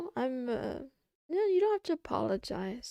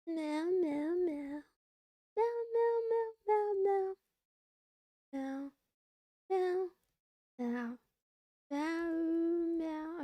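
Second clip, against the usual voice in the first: playful cat meows; 4 semitones higher, 4 dB quieter, slower than usual. Pretend. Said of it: "I'm a cat".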